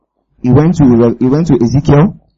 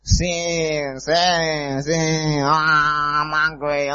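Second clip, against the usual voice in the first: put-on much softer, slow whiny baby voice; cranky hungry baby; 11 dB quieter, much slower than usual. Preacher mocking the idea of God as a cranky hungry baby, soft slow whine. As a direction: whimper like a hungry baby wanting sin. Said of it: "stop making God look like one baby".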